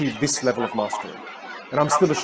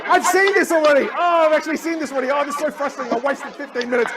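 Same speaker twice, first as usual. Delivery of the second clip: mock frustrated viewer; 13 semitones higher, 4 dB louder than usual. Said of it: "you go".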